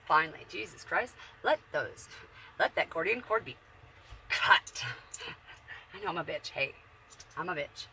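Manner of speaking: ordinary talk, nothing marked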